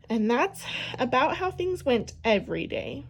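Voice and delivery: ordinary delivery, nothing marked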